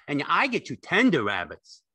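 high, loud, delighted simple fellow; Lenny, eager and childlike. A narrator's reading. Lenny thrilled to tend rabbits, high and loud.

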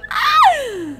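very high squealed "ah"; piercing little cry of delight. Real, not acted. Squeals at cute scene.

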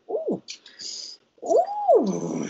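high mock moans of pain; imitating her labor groans; contraction moans. Moaning through a contraction.

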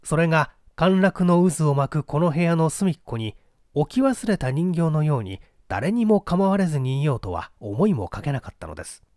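usual speaking voice

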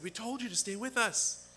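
high mock scolding parent voice; lightly hammed-up scolding. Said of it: "they said".

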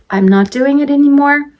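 high, held last word; singsong refusal. Real, not acted. Mom swearing off store trips with twins, singsong.

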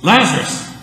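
high loud call of the name. Retold or imitated. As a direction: shout the name to wake him. Jesus calls again.

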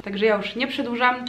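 usual speaking voice

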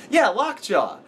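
put-on high curious little boy; kid voice. Kid asks about the drawing, in a high voice. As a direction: ask it like a small kid.

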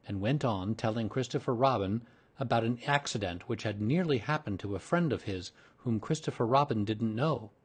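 usual speaking voice

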